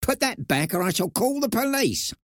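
very high, sharp threat; a tiny mouse sternly warning; sharp little voice. A narrator's reading. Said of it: "speaking to her very sharply".

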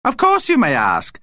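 high, grinning vampire voice; beaming little vampire. A narrator's reading. Vlad answers Dad's question, beaming. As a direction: say it high through a broad smile. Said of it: "smiling broadly".

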